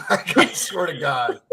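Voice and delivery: laughing. Real, not acted. Laughing about scar.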